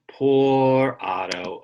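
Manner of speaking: slow sympathetic sigh of a refrain; pitying. Acted. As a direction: draw it out with pity. Story refrain read aloud.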